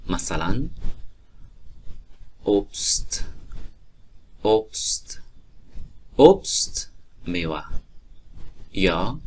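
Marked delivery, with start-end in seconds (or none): 2.4-7.6 اوبست ending crisp st, not sht; final st kept as st. Shown as a demonstration. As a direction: say اوبست as obst with plain s-t.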